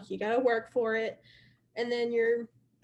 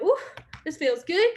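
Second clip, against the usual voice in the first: higher, louder pleased ooh; excited; 4 semitones higher, 4 dB louder than usual. Pretend. Recruiter acts out a team member getting excited. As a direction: play up a rush of good feeling. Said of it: "you start getting excited and you're like".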